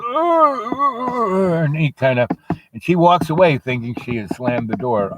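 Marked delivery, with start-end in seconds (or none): 0.0-1.6 long high groan, falling off; pained groaning. Acted. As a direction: groan like a foot caught in a door. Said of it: "And he goes".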